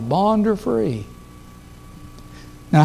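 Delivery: usual speaking voice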